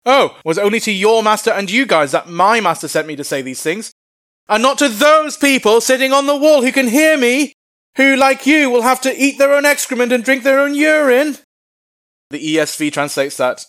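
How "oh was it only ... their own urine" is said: high-pitched shout to carry up to the people on the wall; booming, high commander's reply; loud public taunt. Acted. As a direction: bellow it past the officials to the crowd. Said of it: "the commander replied loudly in Hebrew".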